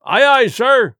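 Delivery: loud high call of aye aye; cried out. Acted. Cook calls back to the squire, a loud high cry. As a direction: sing it out from the passage. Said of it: "cried the cook in the passage".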